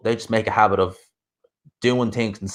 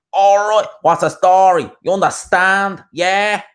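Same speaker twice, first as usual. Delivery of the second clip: put-on loud, high-pitched rough Dublin accent; hard Dublin accent; 9 semitones higher, 6 dB louder, slower than usual. Lad mimicking loud locals a country girl hears, in a hard Dublin accent. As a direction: put on an abrasive, obnoxious Dublin lad's accent. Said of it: "I'm gonna do a real hard accent".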